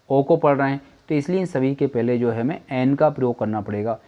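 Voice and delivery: ordinary delivery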